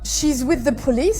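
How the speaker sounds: ordinary talk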